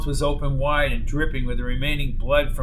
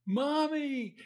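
put-on very high, softer child's cry; kids yelling; 11 semitones higher, 7 dB quieter than usual. A narrator's reading. Kids running to their mother as she leaves the car, high child's yell. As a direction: narrator voicing children calling out for their mother. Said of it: "yelled the kids".

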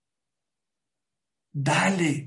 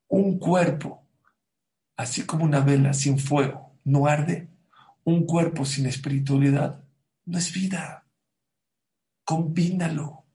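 preaching; 2 semitones higher, 5 dB louder than usual. Acted. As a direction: drive the message home.

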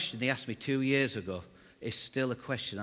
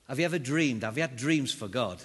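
ordinary talk

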